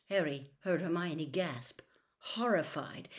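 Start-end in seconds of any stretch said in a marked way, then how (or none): none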